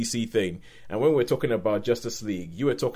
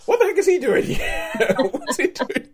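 high, loud squeal of mock disbelief; laughing bewilderment; 4 dB louder than usual. Viewer spotting Eddie Marsan in Hancock, laughing bewilderment. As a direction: break into laughter on a baffled, high-pitched question. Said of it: "I remember watching Hancock and going".

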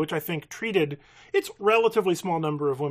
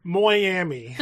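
"Miami" as "Moi-ami" in a hammed-up Irish accent, louder, through a laugh; terrible Irish accent; 2 semitones higher, 5 dB louder than usual. Reading her notes aloud to a co-host, mock Irish "Miami". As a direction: imitate a bad Irish accent on "Miami", giggling. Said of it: "saying Miami in a terrible accent".

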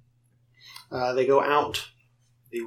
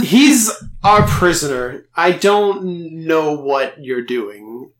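incredulous guard; 4 semitones higher, 7 dB louder, slower than usual. Acted. Guard refusing the stranger.

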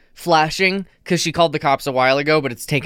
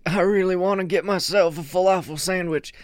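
lightly voiced, higher-pitched president; mock president quote; 5 semitones higher than usual. President craving a late-night falafel, lightly acted longing. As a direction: play a yearning president, slightly hammed-up. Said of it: "and he's just like".

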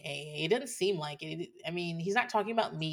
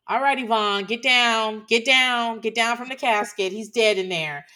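mock firm command; 4 semitones higher, 8 dB louder than usual. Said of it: "I'm gonna have to be like".

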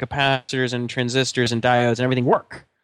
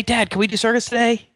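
put-on eager kid; 9 semitones higher than usual. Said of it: "he's like".

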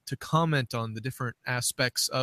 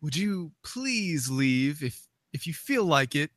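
mock timid request; 5 semitones higher than usual. Co-host spoofing a prayer without authority, mock meek request. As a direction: plead feebly as a joke, tentative to the end.

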